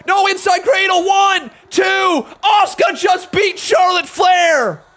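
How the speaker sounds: high, loud shouted match call; wrestling commentator. Acted. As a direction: yell the finish like ringside commentary. Match finish shouted play-by-play.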